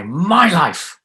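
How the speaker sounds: "my" loud and high, "life" falling after it; stress on my. Shown as a demonstration. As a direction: hit the word "my". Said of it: "Emphasis on my".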